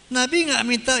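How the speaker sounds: usual speaking voice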